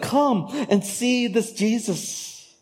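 slow, high inviting call; mock Philip. Pastor voicing Philip calling Nathaniel, high and slow. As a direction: coax him to come and see, drawn out.

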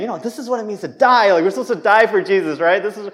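loud, high defensive excuse; a touch of defensive piety; brushing it off. Retold or imitated. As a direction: brush the question off with a pious line. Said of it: "tried to brush it off".

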